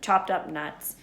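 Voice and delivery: usual speaking voice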